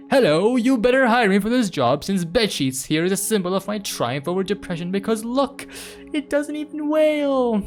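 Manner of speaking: loud, high, hammed-up proclamation; over-the-top boast, rising in pitch; mock triumphant declaration. Acts out speech to the interviewer.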